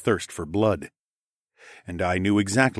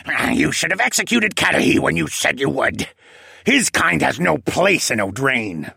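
hateful squawk; 7 semitones higher, 4 dB louder than usual. A narrator's reading. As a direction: spit out contempt as a squawking penguin. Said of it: "the penguin squawked with a hateful glare".

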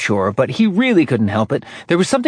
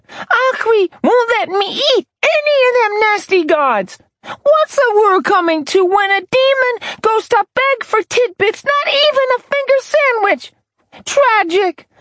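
put-on high demon girl voice; 4 dB louder than usual. A narrator's reading. Simi the demon complains.

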